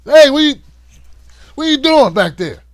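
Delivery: loud, high, offended complaint; mock indignant yell. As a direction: yell back like someone interrupted mid-checkup. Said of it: "It's like".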